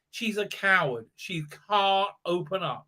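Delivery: ordinary talk, nothing marked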